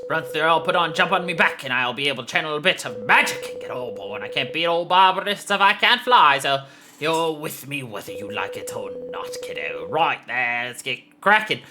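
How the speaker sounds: Australian dragon mentor. Acted. Dragon readying the kiddo to fly, Australian character voice. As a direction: play a matey, confident Australian mentor.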